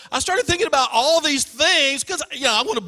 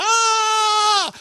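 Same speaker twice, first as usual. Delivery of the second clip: high-pitched mock scream of a frightened sister; 11 semitones higher, 4 dB louder than usual. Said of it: "She's screaming".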